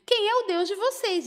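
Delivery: high-pitched taunting challenge; defiant challenge. Retold or imitated. King challenging the three young men's God, defiant. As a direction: throw the question down as a dare.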